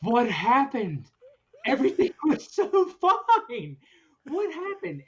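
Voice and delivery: high, slow, stunned laughing disbelief; high, slow retold shock with a laugh in it. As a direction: act out gaping at the mess in disbelief. Retelling his shock at the mess.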